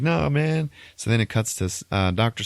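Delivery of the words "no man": higher, mock-disappointed plea; lightly acted letdown as a friend walks out; retold dismay. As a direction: protest the departure in dismay. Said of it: "And they're like".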